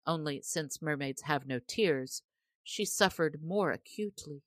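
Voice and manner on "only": plain reading voice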